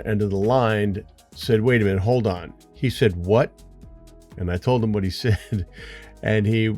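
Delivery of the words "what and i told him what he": ordinary talk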